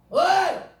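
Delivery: brash, high-pitched shout; loud acted hail; hailing a peer. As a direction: holler casually to someone your own age. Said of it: "나이가 비슷하니까 뭐라는 줄 알아요".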